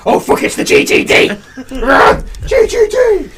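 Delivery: high loud panicked shout; mock panic. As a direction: scream a warning and run.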